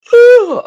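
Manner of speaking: big exaggerated sigh, high and loud. Real, not acted. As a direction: let out a huge theatrical sigh. Big sigh before photo bomb plan.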